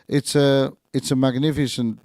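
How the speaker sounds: ordinary talk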